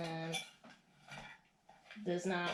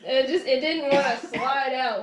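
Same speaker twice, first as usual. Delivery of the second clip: laughing apology; 5 semitones higher, 7 dB louder than usual. Real, not acted. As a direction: laugh while you say it. Laughing while wrestling the tart out.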